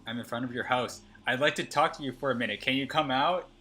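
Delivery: reading the stalker's text out loud and pushy; loud creepy message. Acted. Streamer voicing a stalker outside her house, loud and looming. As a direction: lean in loud, like he's at the door.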